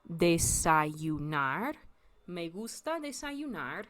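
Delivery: desayunar drawn out slowly, syllable by syllable; slow careful desayunar for learners; slow demo. Shown as a demonstration. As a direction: stretch out desayunar slowly so it can be copied. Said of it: "a little harder for people to say".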